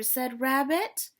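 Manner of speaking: put-on expressive read-aloud for kids; storybook reading. A narrator's reading. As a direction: read it like a picture book to children. Teacher reads storybook to class.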